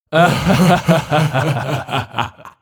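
loud sinister cackle; evil demonic laugh. Acted. Demon laughs at helpless Gareth, loud and evil. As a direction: laugh darkly at the victim.

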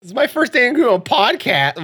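cracking voice from illness. Real, not acted. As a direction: voice breaking up high. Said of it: "Why is my voice cracking again?".

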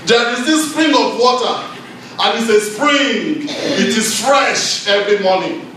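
high-pitched, insistent sermon delivery; urgent exhortation, pitch held high; impassioned preaching. Real, not acted. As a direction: drive each phrase home to the congregation. Preaches at raised intensity.

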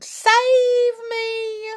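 very high, loud scream for help. A narrator's reading. Wife shooting into the sky, screaming high and loud. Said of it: "she screamed".

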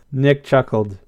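ordinary delivery, nothing marked

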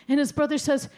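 normal talking voice

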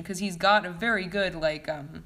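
ordinary talk, nothing marked

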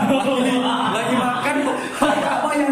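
high laughing squeal; laughing disbelief. Real, not acted.

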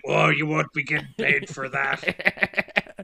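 Willem Dafoe voice. Retold or imitated. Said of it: "Willem Dafoe's like".

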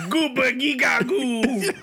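high, slow gibberish chant; fake foreign language. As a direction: mimic a made-up tongue. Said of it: "an amazing fake language".

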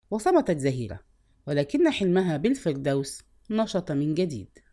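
ordinary delivery

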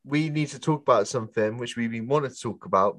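ordinary talk

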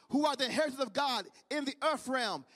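normal talking voice

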